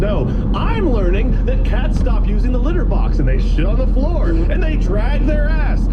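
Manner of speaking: normal talking voice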